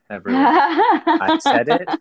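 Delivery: laughing triumph. Real, not acted. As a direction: crow it through a laugh.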